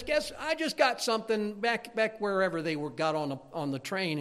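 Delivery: lightly voiced cocky boy, starting high; cocky kid. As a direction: imitate a boy showing off, pitch easing down. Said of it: "kind of cocky kid".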